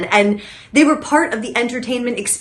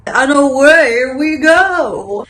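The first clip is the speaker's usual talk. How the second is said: loud, drawn-out launch call; showy send-off; 4 semitones higher, 6 dB louder, much slower than usual. Pretend. Host sending viewers back to the ads, showy drawn-out call. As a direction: stretch it out like a showman.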